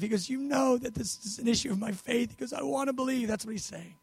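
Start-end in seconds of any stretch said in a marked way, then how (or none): 0.3-2.3 soft, lightly tearful confession; acted tearful pleading. The father admitting his weak faith, acted tearful. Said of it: "He's crying now". 2.5-3.3 soft, higher-pitched longing; acted tearful pleading. The father wanting to believe, acted tearful. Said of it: "He's crying now".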